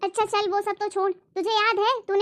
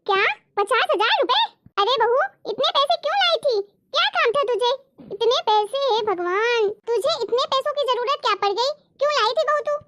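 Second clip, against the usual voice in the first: shocked mother-in-law; 5 semitones higher than usual. Acted. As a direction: gasp at the sum like a stunned mother-in-law. (ऊँची, तेज़ आवाज़ में चौंकी हुई सास)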